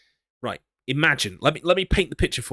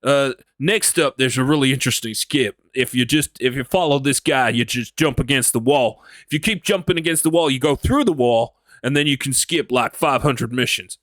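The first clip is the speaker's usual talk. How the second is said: Texan cowboy voice; 4 dB louder than usual. Shown as a demonstration. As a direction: explain the skip like a Texan cowboy. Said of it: "And I'm talking like this".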